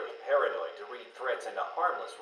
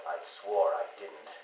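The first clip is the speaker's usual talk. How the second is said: subdued hurt; 5 semitones lower, 5 dB quieter than usual. Acted. Recounts being hit after father's outburst.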